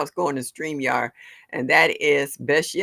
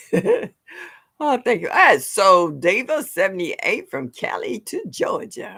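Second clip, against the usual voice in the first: usual speaking voice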